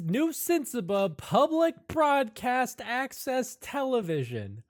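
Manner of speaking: loud, high, drawn-out show opening; show announcer. Acted. GM opens Savannah J's public access show, hyped announcer. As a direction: belt out the big intro, building to a whoop.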